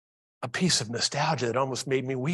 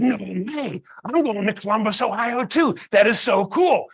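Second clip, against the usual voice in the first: put-on higher, louder gushing boy; amazed kid imitation, pitched up; excited kid; 5 semitones higher, 5 dB louder than usual. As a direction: burst out like a thrilled child. Acts boy amazed at shared destination.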